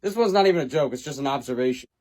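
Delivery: ordinary delivery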